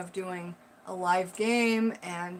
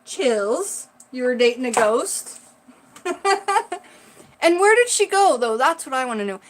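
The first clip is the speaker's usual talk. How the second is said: loud, high, thrilled reaction; excited; 3 semitones higher, 10 dB louder than usual. Real, not acted. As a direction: gasp at the twist. Reacting to the ghost twist.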